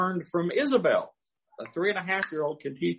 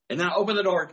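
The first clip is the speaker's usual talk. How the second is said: ordinary delivery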